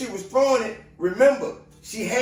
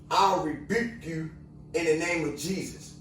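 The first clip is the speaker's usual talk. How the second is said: imitating her rebuke, noticeably softer; soft quoted rebuke; 5 semitones lower, 6 dB quieter than usual. Woman quietly rebuking the cop, softly spoken. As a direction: hush it down, quote her calmly.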